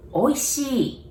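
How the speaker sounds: "Oishii" as oy-shee with a falling end; teacher models "Oishii" clearly, dropping the pitch at the end; Japanese word demo. Shown as a demonstration. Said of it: "We say".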